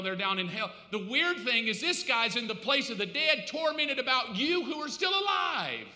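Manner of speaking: forceful sermon delivery; full-force preaching. Real, not acted. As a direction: drive the point home, rising at the end.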